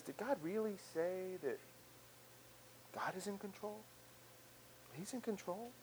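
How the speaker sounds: softly incredulous, planting doubt; insinuating doubt. Acted. Voice of fear questioning God's control, quiet disbelief. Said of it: "it's that voice that says".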